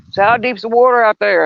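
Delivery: shouting a question across to a boater; calling across the water. Retold or imitated. Calling out to man on boat.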